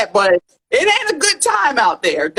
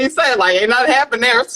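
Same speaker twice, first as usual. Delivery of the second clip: ordinary talk, nothing marked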